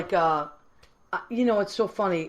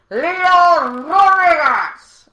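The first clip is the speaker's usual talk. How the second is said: long, stretched, much higher and louder, like a ring announcer; announcer yell; 10 semitones higher, 10 dB louder, much slower than usual. Shown as a demonstration. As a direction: bellow the name out slowly for the crowd. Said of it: "please yell".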